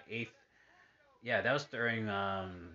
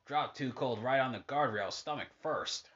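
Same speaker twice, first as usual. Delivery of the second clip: wrestling commentator. Acted. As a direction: narrate the match, spike on the slam. Calling the match on TV.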